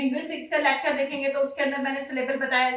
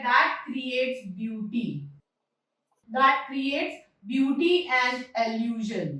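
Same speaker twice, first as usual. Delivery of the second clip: very slow, measured repetition; slow dictation; 2 semitones lower, much slower than usual. Real, not acted. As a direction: dictate it word by word for note-taking. Dictating phrase while writing it. (धीरे-धीरे शब्द दर शब्द लिखवाते हुए)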